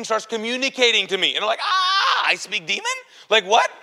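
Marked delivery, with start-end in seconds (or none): 1.6-3.7 high, loud yell into disbelief; hammed-up shock. Teller reacts to the demon communicating with him, mock-shocked yelling. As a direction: replay a panicked, incredulous outburst.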